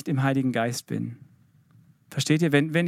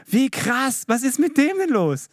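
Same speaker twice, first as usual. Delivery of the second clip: 1 amazed onlookers; 9 semitones higher, 3 dB louder, slower than usual. Pretend. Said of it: "Dass Leute sagen".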